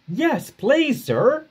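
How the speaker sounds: high, eager engine voice; bright, keen reply to the boss; eager Duck. Acted. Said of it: "replied Duck".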